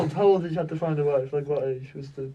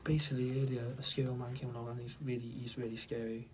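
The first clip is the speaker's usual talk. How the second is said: hushed voice; 4 semitones lower, 9 dB quieter than usual. Real, not acted. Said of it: "why are you whispering?".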